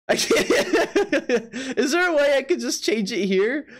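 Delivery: laughing. Real, not acted. Streamer stuck with a filtered link, laughing helplessly. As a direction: talk on through the giggles.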